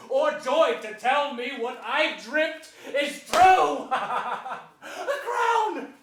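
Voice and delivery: loud, slow build into a laugh and a high cry; gloating triumph. Acted. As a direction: savour the prize, then crow. Richard alone, gloating.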